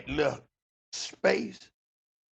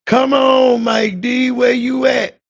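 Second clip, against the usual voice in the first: high, loud raging shout; hollering and screaming; 11 semitones higher, 13 dB louder, slower than usual. Retold or imitated. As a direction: scream it across the yard. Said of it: "hollering and screaming".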